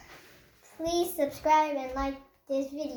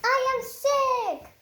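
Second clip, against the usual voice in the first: high, louder startled cry; alarmed realization; 10 semitones higher, 4 dB louder than usual. Acted. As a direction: gasp it out in shock. Gerald realizes he is sick.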